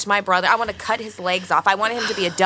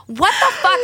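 shocked outburst; 10 semitones higher than usual. Retold or imitated. Retold shock at grandma.

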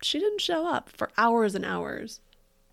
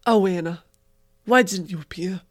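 put-on light Staten Island accent, a bit louder; 7 dB louder than usual. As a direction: read the title like a Staten Island guy. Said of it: "in my head I read".

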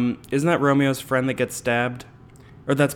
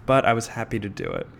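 ordinary talk, nothing marked

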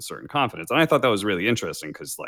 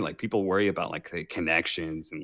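ordinary delivery

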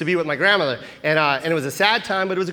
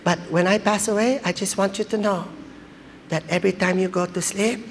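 grandma; 2 semitones higher than usual. Retold or imitated. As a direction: build up a solemn promise as an old grandma. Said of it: "Grandma, please don't talk".

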